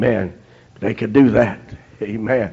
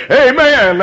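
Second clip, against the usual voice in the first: usual speaking voice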